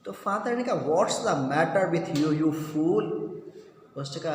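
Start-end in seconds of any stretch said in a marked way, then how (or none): none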